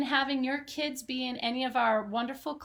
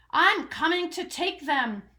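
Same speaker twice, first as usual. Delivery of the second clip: greedy yell; 5 semitones higher, 5 dB louder than usual. Acted. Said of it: "the rat thief yelled".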